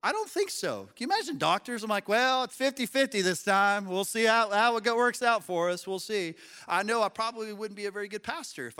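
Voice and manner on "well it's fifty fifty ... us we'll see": lightly voiced noncommittal doctor, higher and louder; mock doctor. Pastor imagining doctors at fifty-fifty odds, mock-casual. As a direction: play a doctor hedging the odds.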